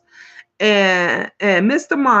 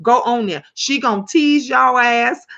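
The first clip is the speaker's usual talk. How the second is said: usual speaking voice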